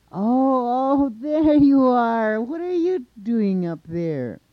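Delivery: booming high shout of recognition; loud, high, drawn-out hail; calling up. A narrator's reading. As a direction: holler up slowly, stretching the words. Monster spots Coyote, calls up.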